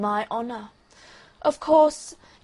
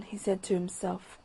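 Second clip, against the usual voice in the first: plain reading voice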